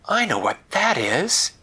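high excited gasp; excited child. Acted. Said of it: "Winella said".

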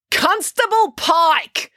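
loud, high, long-drawn reveal; dramatic accusation. Acted. April names the culprit to the crowd, dramatic cry. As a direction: proclaim the name slowly and loudly.

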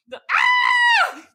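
high startled scream; horrified scream. Shown as a demonstration. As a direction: scream like a murder witness. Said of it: "C S I Miami voice".

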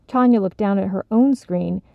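plain reading voice

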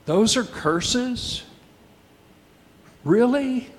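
mock disbelief. Preacher questioning the curses aloud, in mock disbelief. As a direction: act astonished for the congregation.

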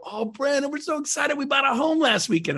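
high excited client voice; gushing client. Retold or imitated. Client telling agent they bought elsewhere, gushing high. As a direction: gush like a happy client.

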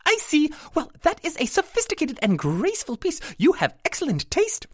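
very high, perky little fairy voice; a tiny proprietor, high and chirpy; pixie shopkeeper. A narrator's reading. Pixie praises Sarah's choice.

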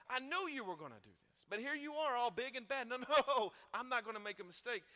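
loud, high, hammed-up scolding; mock scolding. Imagined Jesus scolding Peter for denial, loud mock anger. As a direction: lash out at him in mock anger.

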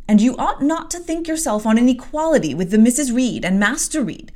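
lecturing servant. Acted. Abbott to Jane, brisk scolding lecture. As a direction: put the girl in her place.

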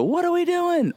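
high, frantic mock panic; panicked cry. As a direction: throw up your hands and shriek it. People running around panicking.

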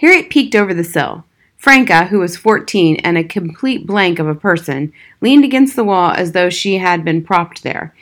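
usual speaking voice

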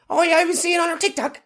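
high-pitched gossip; nosy local voice. Pretend. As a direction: gossip like a village busybody.

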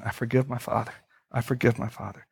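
hushed prayer. Shown as a demonstration. Pastor modelling a quiet private prayer, hushed. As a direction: speak it under your breath like a private prayer.

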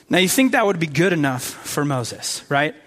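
ordinary delivery

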